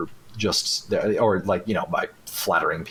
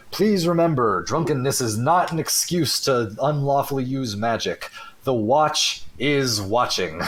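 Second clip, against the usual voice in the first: higher-pitched public announcement, slowing at the end; town crier calling out; 5 semitones higher than usual. Acted. Crier on the rooftops warns drinkers about magic, mock proclamation. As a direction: proclaim it like a crier, without a full yell. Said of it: "yelling out about".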